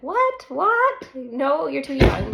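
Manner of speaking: high, loud incredulous "What? What?"; imitating people's surprised reaction; mock disbelief. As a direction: play the shocked friends. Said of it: "A lot of people are like".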